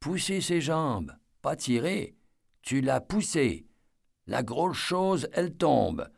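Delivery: put-on a small girl patiently telling him the truth; little girl voice. A narrator's reading. Narrator voices the child Tala.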